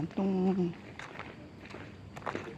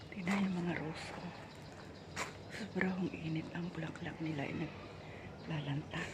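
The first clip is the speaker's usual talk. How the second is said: much softer, keeping voice down indoors; hushed; 12 dB quieter than usual. Real, not acted. Maid filming indoors while boss watches, hushed.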